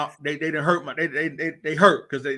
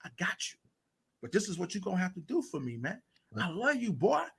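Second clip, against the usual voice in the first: much softer, intimate and reassuring; tender talk; 11 dB quieter than usual. Retold or imitated. Coach speaking privately to a struggling boy, very soft and tender. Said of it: "I'm like".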